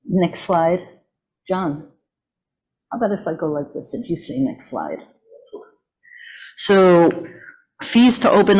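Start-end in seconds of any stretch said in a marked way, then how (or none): 1.5-5.0 much softer, quick aside; quiet aside. Real, not acted. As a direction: drop your voice for an aside. Quiet aside to slide operator.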